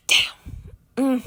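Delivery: breathed out with no voice; whispered damn. Real, not acted. Vlogger gossiping to viewers, whispering damn. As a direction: hush the word like gossip. Reacting to messy scene.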